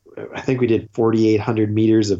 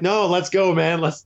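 high, keen mock brush-off; imitating his own eager self; eager retort; 7 semitones higher than usual. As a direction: urge him on, pitched up. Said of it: "I'm like".

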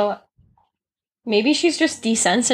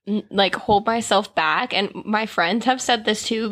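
ordinary delivery

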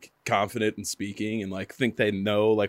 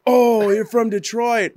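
mock dumb listener; 13 semitones higher, 8 dB louder, much slower than usual. Mimicking confused listener.

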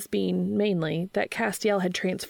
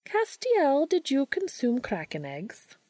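high witch voice, mildly annoyed; a haughty witch setting them straight; Rowena, mildly annoyed; 8 semitones higher, slower than usual. A narrator's reading. Rowena questions Castiel.

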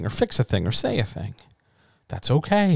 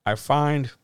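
ordinary talk, nothing marked

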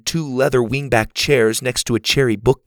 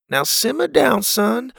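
calming father; 12 semitones higher than usual. A narrator's reading. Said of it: "Pa said".

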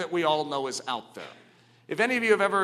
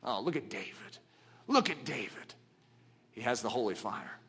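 jeering brothers; 6 semitones lower, 9 dB quieter than usual. Retold or imitated. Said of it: "They jeered at him".

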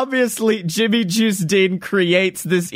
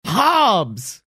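higher, drawn-out exclamation; calling out; 6 semitones higher than usual. Real, not acted. Co-host supplying a forgotten character name, calling out. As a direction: call the name out as a sudden reminder.

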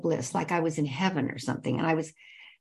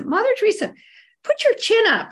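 much higher, louder, shrill-pitched order in a put-on voice; condescending bossy wife; 13 semitones higher, 6 dB louder than usual. Overbearing wife ordering Mother Teresa to lift her chin, a loud condescending command. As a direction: talk down to her like a pushy tourist.

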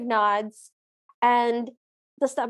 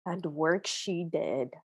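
low, soft, slow narration; hushed low voice, measured pace; film narrator; 7 semitones lower, 8 dB quieter, slower than usual. Retold or imitated. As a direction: imitate a solemn storyteller. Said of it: "the narrator goes".

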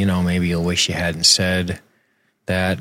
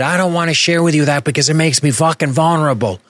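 higher, mocking an overly literal confession; mock bad dialogue; 7 semitones higher than usual. Host mocking bad screenwriting, higher mock earnestness. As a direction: ham up the clumsy line. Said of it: "If he would have said".

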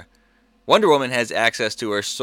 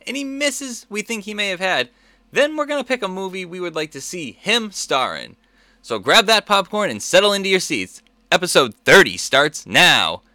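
high, building showman intro; hyped announcer voice; 9 semitones higher, 4 dB louder than usual. Acted. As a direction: build the hype to the start. Host's rising show intro.

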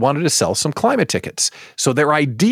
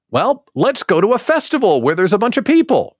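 high, louder mock brainstorming voice; eager pitch; 10 semitones higher, 4 dB louder than usual. Staffer pitching in the decision-making room, eager. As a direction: pitch it like an over-keen team member. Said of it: "let's go into the decision-making room".

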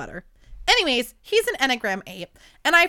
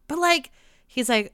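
ordinary delivery

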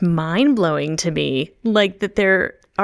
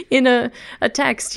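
ordinary delivery

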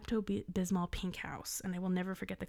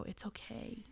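soft, hushed parental comfort; quietly soothe, imitating a parent; gentle reassurance; 3 semitones higher, 10 dB quieter, slower than usual. Parents having to look at their kid, gently reassuring.

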